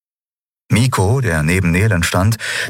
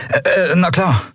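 1 verlegen; 11 semitones higher than usual. Acted. Miko verlegen.

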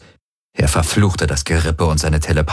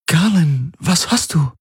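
much higher, anxious woman's voice; alarmed concern; 11 semitones higher than usual. A narrator's reading. Ylana sees Galen groan in pain, alarmed concern. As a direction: ask high, startled by his pain.

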